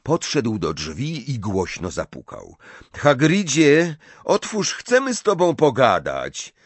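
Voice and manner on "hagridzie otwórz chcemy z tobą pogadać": raised, high-pitched call through a door; pitched-up voice calling someone out. A narrator's reading. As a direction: call to be let in. Harry calling through a closed door.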